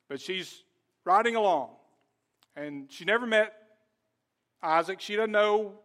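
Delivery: normal talking voice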